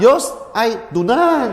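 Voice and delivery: 笑着慢速示范"Yos爱Dunan"; slow Mandarin demo, laughing. Shown as a demonstration. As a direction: model "Yos, ai Dunan" slowly, amused. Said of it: "sekarang saya rubah lagi".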